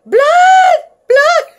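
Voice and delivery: piercing high scream of horror; panicked child's scream; hollered. A narrator's reading. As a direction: shriek it at full volume. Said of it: "I hollered some more".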